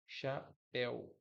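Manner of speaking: "Chapéu" devagar, cha... péu, com pausa no meio; sílaba por sílaba. Shown as a demonstration. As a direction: separe as sílabas de "Chapéu", deixando o péu aberto. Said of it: "Ditongo aberto em el". ("Chapéu" silabado, devagar)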